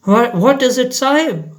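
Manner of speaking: high, quick alarmed watchman; startled watchman. Acted. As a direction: ask in sudden alarm. Indian watchman asks, startled.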